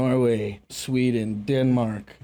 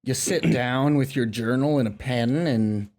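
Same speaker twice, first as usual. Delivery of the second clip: put-on Southern accent. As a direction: stretch the vowels like a languid Southerner. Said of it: "I gotta stop talking like that".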